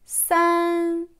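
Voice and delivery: "San" held high and level; first tone. Shown as a demonstration. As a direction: sustain "San" loud on one high note. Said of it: "Premier ton".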